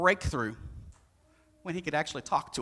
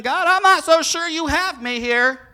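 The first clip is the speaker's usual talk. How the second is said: anxious protest; 10 semitones higher, 11 dB louder than usual. Pretend.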